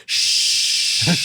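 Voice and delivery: long, drawn-out shush; firm "shh". Retold or imitated. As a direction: hush someone with a sustained shh. Shushing the girl in retelling.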